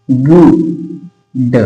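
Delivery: गुड stretched very slowly to show its उ sound; drawn-out demo. Shown as a demonstration. Teacher sounding out गुड slowly for students. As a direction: hold out गुड so the class hears the उ. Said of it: "उ असा उच्चार होतोय का?".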